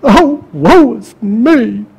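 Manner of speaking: slow, drawn-out whining sigh; mock Jacob's self-pity; a slow, hammed-up lament of woe. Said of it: "Jacob's attitude was".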